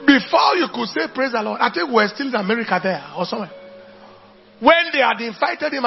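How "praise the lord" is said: usual speaking voice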